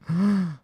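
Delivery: sudden audible intake of breath; shocked gasp. Real, not acted. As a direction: gasp at bad news. Finds out they are banned.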